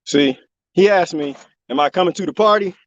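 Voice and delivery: ordinary delivery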